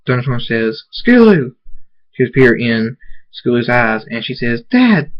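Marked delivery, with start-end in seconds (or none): 0.9-1.5 high, loud cry that falls away; excited call. A narrator's reading. Father spotting his daughter, calling out excitedly. 4.7-5.1 put-on high, loud childlike exclamation; excited cry. A narrator's reading. Daughter seeing her father back, crying out excitedly.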